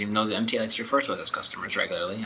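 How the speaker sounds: ordinary delivery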